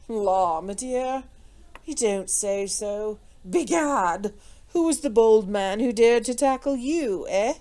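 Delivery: drawn-out, untroubled gentlemanly banter; amused lord taking it all in his stride; placid. A narrator's reading. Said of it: "he rejoined placidly".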